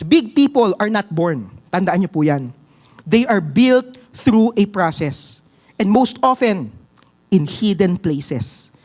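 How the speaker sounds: ordinary talk, nothing marked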